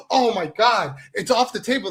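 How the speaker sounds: hammed-up dismay at trivial news; mock shock. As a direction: gasp and act scandalized, sarcastically. Mockingly reacts to the booker's withdrawn invite.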